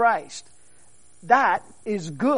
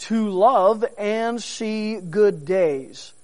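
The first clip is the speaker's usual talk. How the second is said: slow emphatic quote; much slower than usual. Acted. Slow reading of scripture phrase.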